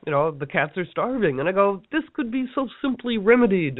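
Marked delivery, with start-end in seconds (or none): 1.9-3.8 higher, exasperated complaint; exasperated sigh of a remark. Retold or imitated. At the Temple of Isis with starving cats, higher exasperated complaint. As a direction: pitch it up, fed up.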